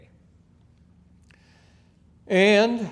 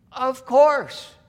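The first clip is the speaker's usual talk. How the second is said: loud, much higher exclamation; emphatic answer; 13 dB louder than usual. Real, not acted. Preacher answering his own question to the congregation, loud. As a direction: declare it as beyond doubt.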